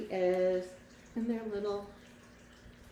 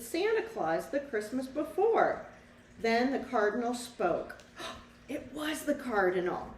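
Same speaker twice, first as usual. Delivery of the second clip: lively storybook read-aloud for a class; reading to children; 4 dB louder than usual. A narrator's reading. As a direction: tell the story to the children, bright and clear. Teacher reads picture book aloud.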